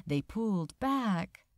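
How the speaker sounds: usual speaking voice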